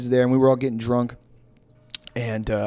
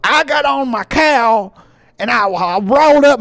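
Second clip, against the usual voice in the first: put-on drawn-out, higher caricature of an American cowboy accent; European's American accent; 9 semitones higher, 5 dB louder, slower than usual. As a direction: overdo a foreigner's fake American accent, loud and slow. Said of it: "do an American accent".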